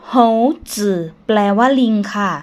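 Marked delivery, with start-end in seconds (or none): 0.1-1.0 "โฮ จือ" said as a Mandarin word, syllable by syllable; teacher's model of Chinese "โฮ จือ", two separate syllables; Mandarin pronunciation. Shown as a demonstration. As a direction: sound out "โฮ จือ" slowly in Chinese. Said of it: "คำนี้อ่านออกเสียงว่า".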